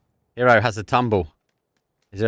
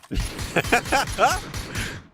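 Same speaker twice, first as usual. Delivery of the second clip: very high, breathy, voiceless laughing; high silent wheeze of laughter; wheezing laugh. Real, not acted.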